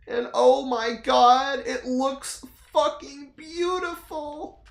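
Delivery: slow, high amazed gushing; awestruck gush. Real, not acted. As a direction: savor every word in awe. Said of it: "gushing over trailer".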